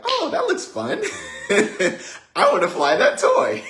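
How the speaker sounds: put-on very high, laughing little-girl voice; little sister voice. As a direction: pipe up high like an eager little kid, giggling through it. Voicing little sister admiring toy.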